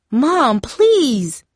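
high, stretched-out begging; desperate plea. A narrator's reading. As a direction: beg a mother to stop. Darcy pleads between fighting mother and sister.

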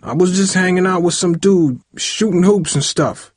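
high-pitched anxious confession; shaky voice. A narrator's reading. Son admits to father how he spent the day, shaky. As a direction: confess nervously, braced for trouble. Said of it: "said in a shaky voice".

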